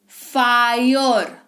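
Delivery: फायर stretched slowly as "faa-yor"; drawn-out pronunciation. Shown as a demonstration. Teacher showing how फायर is read, slow and drawn out. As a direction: sound out फायर with a long "aa-yo". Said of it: "तो ये पढ़ा".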